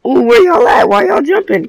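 high, loud questioning of teammates; high-pitched exclamation. Real, not acted. Gamer asks teammates why they're jumping, high exclamation. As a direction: call out high to your teammates. Reacting to teammates during play.

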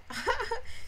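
short high giggle; laugh. Real, not acted. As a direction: burst of high-pitched laughter. Laughs at late hour.

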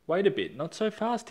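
high, prickly objection; bristling. Acted. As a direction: bristle with indignation. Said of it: "he bristled".

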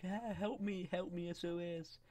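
high, drawn-out pretend plea; mock cry for help. Game character begging for help, high mock distress. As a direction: call for rescue in a high put-on voice. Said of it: "He's like".

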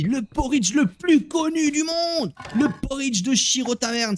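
high, stretched showman's boast; hammed-up announcer fanfare; grand hype. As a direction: proclaim it grandly, drawing out the words. Hypes his porridge like a showman.

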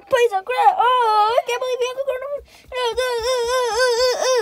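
high loud frantic wail; panicked wailing. Acted. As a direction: shriek in panic. Coronavirus panic scene.

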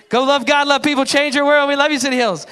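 loud, high, cheery preacher send-off; mock-enthusiastic farewell to the congregation; upbeat sign-off. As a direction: rattle it off brightly like closing a service.

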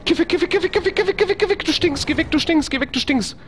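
high, frantic rapid-fire chant; panisches Abwehren. Real, not acted. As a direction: repeat it breathlessly fast as if fending something off. Frantically repels game enemy. (in Panik hektisch und hoch wiederholen)

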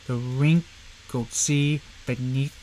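slow measured recital with each beat marked; half-speed reading of the whole poem. Shown as a demonstration. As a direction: read it unhurried, leaning on the beats. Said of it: "gonna read this one more time".

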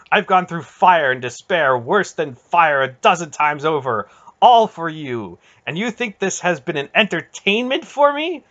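loud, mounting impassioned rebuke; intense. A narrator's reading. Aunt telling Garion all she has endured, intense. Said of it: "the intensity of Aunt Paul's words".

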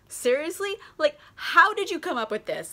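high-pitched mock incredulity; Hermione laying into him. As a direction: imitate an indignant wife challenging an accusation. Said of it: "lays into Leontes".

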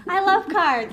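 high, loud fake enthusiasm, laughing; mock eager. Girl faking she knows cards to Boston cousin, high and laughing. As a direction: pretend you know the game, way too eager. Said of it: "and I'm like".

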